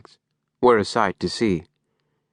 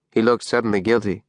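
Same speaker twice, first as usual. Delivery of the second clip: usual speaking voice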